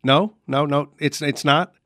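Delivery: usual speaking voice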